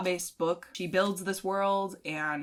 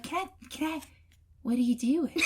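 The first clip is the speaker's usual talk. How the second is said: coy high voice; 8 semitones higher than usual. Retold or imitated. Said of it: "And she'd be like".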